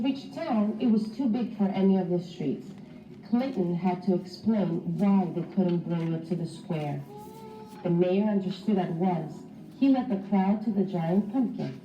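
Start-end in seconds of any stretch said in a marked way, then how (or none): none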